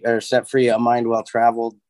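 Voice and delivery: ordinary delivery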